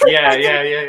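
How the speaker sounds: laughing yeah, loud. Real, not acted. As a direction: laugh it out loudly. Still laughing about the name.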